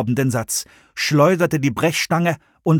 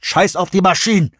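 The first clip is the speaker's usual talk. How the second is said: higher, louder curt retort; blunt dismissal; 7 semitones higher, 4 dB louder than usual. A narrator's reading. Jones cutting off Nick, blunt retort. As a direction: cut him off hard. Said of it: "sagte Jones".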